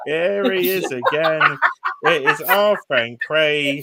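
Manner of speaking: slow, loud showman's introduction; big, slow presenter delivery; drawn-out announcement. Real, not acted. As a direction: stretch it out like a grand reveal. Host presents friend's face on screen.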